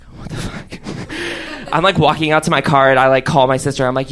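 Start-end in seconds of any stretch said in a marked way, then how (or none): 0.0-0.8 unvoiced, softer whispered "What the fuck?"; whispered disbelief. Learning parents know. 0.8-1.7 nervous whispered laugh. Real, not acted. After the shocked whisper.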